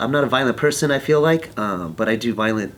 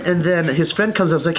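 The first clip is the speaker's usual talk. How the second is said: ordinary delivery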